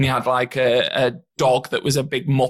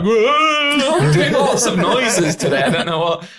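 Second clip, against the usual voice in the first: laughing mock voice; 7 semitones higher, 3 dB louder than usual. Door knocker voiced, laughing.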